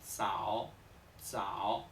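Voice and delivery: "Zao" con "ts" sin soltar aire; "Zao" grave, sin aire. Shown as a demonstration. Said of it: "sin aire". ("Zao" sin aspirar)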